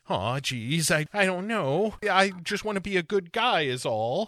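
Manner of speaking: sheepish little nice-guy voice; apologetic pushover mumble; timid Jekyll. Retold or imitated. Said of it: "he's like".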